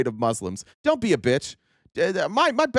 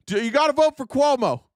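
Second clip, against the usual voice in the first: high, loud pleading push; mocking a pundit's panic; hammed-up panicked pundit; mock urging; 11 semitones higher, 4 dB louder than usual. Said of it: "is like".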